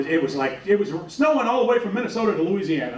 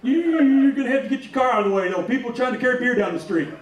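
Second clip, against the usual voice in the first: state cop; 5 semitones higher than usual. Retold or imitated. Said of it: "there's a state cop right there".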